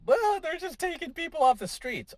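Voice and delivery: high, exaggerated mock whine; mocking high-pitched protester voice; mocking liberal. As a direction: sneer it in a silly high voice. Mocking an opponent's claim.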